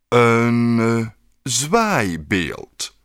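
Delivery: slow, hesitant and drawn out; slowly. Acted. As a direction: stretch each word as if puzzled. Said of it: "vroeg de beeldenmaker langzaam".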